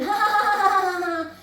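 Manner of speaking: hammed-up group laughing; mock mocking laughter. Teens laughing at comic, imitated. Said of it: "they're all laughing".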